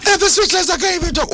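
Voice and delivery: lightly mimicking a strained post-match voice; a hint of a hoarse footballer; imitating the player. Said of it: "My voice is gone".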